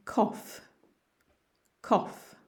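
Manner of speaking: "cough" said as COF; pronunciation model. Shown as a demonstration. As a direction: model "cough" ending on an F sound. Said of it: "think of it as a C O F".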